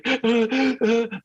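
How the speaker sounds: a sharp high gasp, like a sob catching; imitated sobbing gasp. Describing bawling alone at a movie, imitated sob gasp. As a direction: mimic the gasp of crying in a cinema. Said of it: "I'm literally like".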